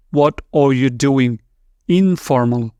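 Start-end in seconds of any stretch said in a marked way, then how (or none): none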